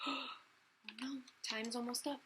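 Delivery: soft gasping alarm; panicked gasp. Acted. Cinderella hearing midnight strike, soft alarmed gasp. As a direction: gasp and hurry it out quietly.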